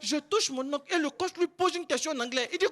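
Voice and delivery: usual speaking voice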